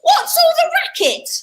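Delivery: shrill Cinderella. Acted. Cinderella calls out.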